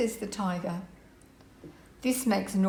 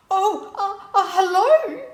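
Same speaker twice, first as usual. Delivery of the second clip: high, loud, faltering hello; taken aback, crying out high; startled cry; 11 semitones higher, 9 dB louder than usual. A narrator's reading. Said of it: "cries Nora".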